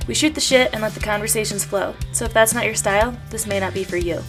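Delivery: ordinary talk, nothing marked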